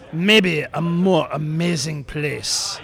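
put-on slow, drawn-out French-accented reading; French character voice, unhurried. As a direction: read it slowly with a fake French accent. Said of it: "another voice we can't understand".

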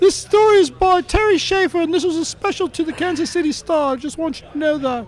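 very high, squeaky bunny puppet voice; high-pitched cartoon rabbit; Sid. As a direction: play a talking rabbit way up high. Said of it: "in Sid's voice".

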